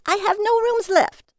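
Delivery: loud, high frightened scream; high loud scream of refusal. Acted. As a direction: scream it to drive him off. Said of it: "she screamed".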